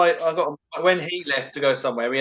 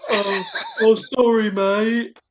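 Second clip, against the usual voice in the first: sheepish Steve; 7 semitones higher, 4 dB louder, much slower than usual. Retold or imitated.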